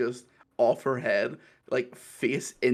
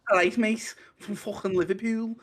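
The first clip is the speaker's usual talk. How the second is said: higher hammed-up Scouse accent; mock Liverpool accent; 8 semitones higher than usual. Scouse accent done when pals ask for one. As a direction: ham up a Scouser, pitched high. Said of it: "Do you wanna give that a go?".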